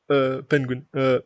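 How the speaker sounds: normal talking voice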